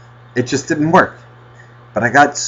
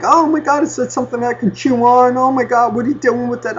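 high, excited dog voice; eager dog; 11 semitones higher than usual. Acted. Dog begging for something to chew, high and eager. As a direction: pipe up like a curious dog. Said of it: "Eris was following me, going like".

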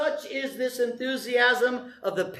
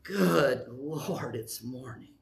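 low, sinking, dragging grumble; mock dreary morning complaint; grumpy morning groan; 8 semitones lower, 6 dB quieter, much slower than usual. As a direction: groan it like you hate waking up. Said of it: "First one is".

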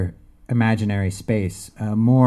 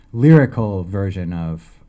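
ordinary delivery, nothing marked

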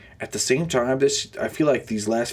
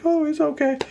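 very high, dismissive mock voice; mock reassurance; 4 dB louder than usual. Mimicking film makers excusing the dog video, mock falsetto. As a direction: imitate a feeble excuse in falsetto.

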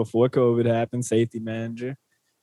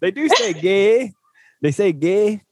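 North Dakota accent; 4 semitones higher, slower than usual. Shown as a demonstration. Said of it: "the North Dakota accent".

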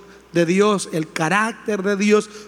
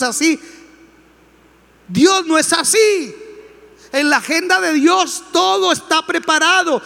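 fiery preaching; 7 semitones higher than usual. Acted. The pastor rebukes frustrated Christians, shouting from the pulpit. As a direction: preach it hard, building to shouts.

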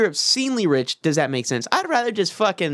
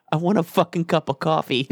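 usual speaking voice

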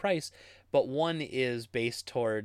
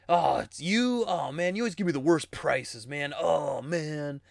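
whiny customer; 4 dB louder, slower than usual. Retold or imitated. Customer whining to the store clerk, a lightly mocked whine. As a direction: groan it out like a sulky regular.

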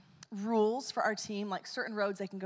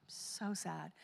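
soft, hushed, drooping imitation of a sad kid; mock sad; 4 semitones lower, 13 dB quieter than usual. The preacher voices the boy leaving the store, much softer and droopy. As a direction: let it droop, a kid who gave up. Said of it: "And he's like".

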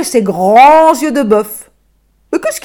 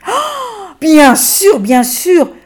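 a high "Oh" then eager, louder agreement; eager yes; 8 semitones higher, 3 dB louder, much slower than usual. Acted. L'âne accepte l'offre de l'enfant, ravi et pressé. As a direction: jump at the offer with delight.

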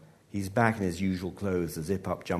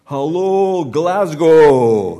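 huge, high-pitched stage greeting; shouted; 8 semitones higher, 16 dB louder, much slower than usual. A narrator's reading. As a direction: holler to a whole audience, stretching the words. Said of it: "he shouted".

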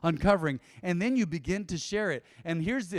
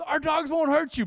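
higher, louder call across the park; retold shout at a panicked dog owner; 10 semitones higher, 5 dB louder than usual. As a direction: yell it to reassure the owner. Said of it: "shouting at the owner".

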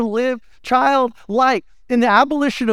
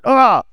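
exclaimed ah; 3 semitones higher, 5 dB louder than usual. Real, not acted. Exclaiming in sermon.

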